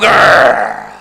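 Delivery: low rumbling dog growl. Retold or imitated. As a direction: growl deep in the throat like a dog.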